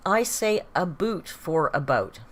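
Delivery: "boot" with a long oo, slowly; "boot" for "about". Retold or imitated. Streamer reading a viewer's claim, slow exaggerated oo in "boot". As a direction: stretch "boot" out, then say "about" normally. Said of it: "Reggae Rat says".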